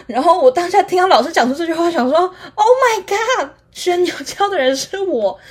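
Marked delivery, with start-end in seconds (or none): 2.2-5.4 high, slow, stunned inner gasp; a touch of mock horror; shocked realisation. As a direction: realise it was you all along. Sudden realisation of own guilt.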